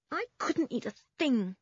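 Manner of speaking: high-pitched, forlorn refusal; forlorn boy. A narrator's reading. Toby turning down lunch, forlorn.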